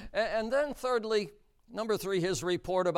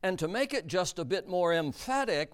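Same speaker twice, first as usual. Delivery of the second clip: usual speaking voice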